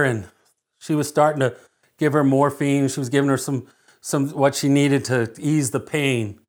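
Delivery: usual speaking voice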